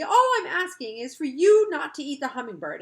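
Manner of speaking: put-on a cross child insisting loudly; irritable. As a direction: keep up the irritation. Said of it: "she said irritably".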